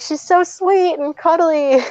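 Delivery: high, drawn-out gushing; cooing over puppy. Retold or imitated. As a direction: coo slowly to win the parents over.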